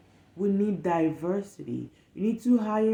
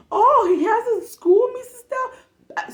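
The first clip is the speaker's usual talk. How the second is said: put-on childlike wonder; 8 dB louder, slower than usual. Voicing the curious child.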